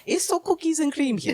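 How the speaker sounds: high, hammed-up cartoon accent; Mario. Riffing on a botched accent, high cartoon plumber voice. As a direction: squeak it out in a silly accent, near laughter. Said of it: "now I'm Mario".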